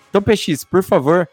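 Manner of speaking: ordinary talk, nothing marked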